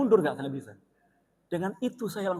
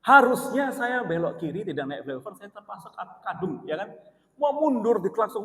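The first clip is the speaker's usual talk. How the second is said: normal talking voice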